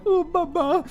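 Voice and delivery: very high whimper; a tiny frightened child; scared child voice. Retold or imitated. Bilbo as a child wanting his teddy. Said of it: "he's like".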